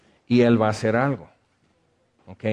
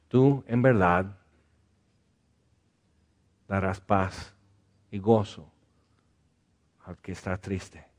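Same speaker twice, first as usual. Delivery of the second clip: earnest pastoral prayer. Acted. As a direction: pray gently over the sad. Leads prayer for the sad.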